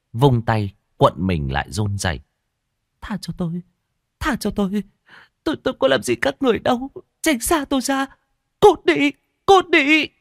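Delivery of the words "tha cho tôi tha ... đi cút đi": terrified screaming plea. A narrator's reading. Woman screams at the ghost monk to leave her. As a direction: beg for mercy, then scream them away. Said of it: "Cô rú lên".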